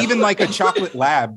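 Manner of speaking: normal talking voice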